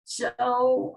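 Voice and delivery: ordinary delivery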